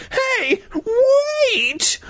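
put-on very high, squeaky kid call. A narrator's reading. Hero calling after the Sadlers, high squeaky shout. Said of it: "I called".

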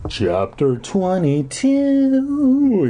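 slow, grand chapter heading; announcer voice. Acted. Podcaster announces the new chapter, drawn out and grand. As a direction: stretch it like a show host.

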